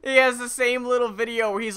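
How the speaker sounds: usual speaking voice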